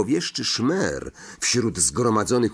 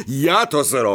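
mildly raised, higher-pitched shout; called out; 3 semitones higher, 4 dB louder than usual. A narrator's reading. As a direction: jump in eagerly to take over. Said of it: "krzyknął Lockhart".

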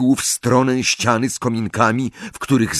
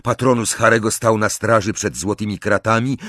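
ordinary delivery

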